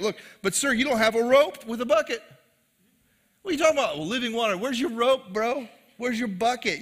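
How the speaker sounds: hammed-up skeptical woman; mock incredulous. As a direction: play her baffled, poking holes. Mocking the woman's confusion.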